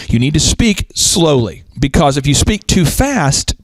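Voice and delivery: slowed down, measured delivery; slow, deliberate advice. Retold or imitated. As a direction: stretch the words out to show slow speaking. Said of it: "you need to speak more slowly".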